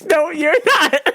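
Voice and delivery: high, slow mock refusal through laughter; stretched, squealed denial with laughing; imitating boss while laughing. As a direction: crack up while playing the boss. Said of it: "then my boss is like".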